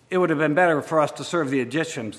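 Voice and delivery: ordinary delivery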